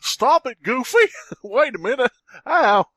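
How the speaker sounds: high squeaky cartoon mouse, angry; angry falsetto cartoon mouse; Mickey Mouse voice. Voicing Mickey.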